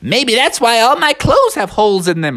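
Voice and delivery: high-pitched little junior voice; high, eager youngster; Junior Bear. Acted. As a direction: pipe up like a small cub. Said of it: "that's when Junior Bear said".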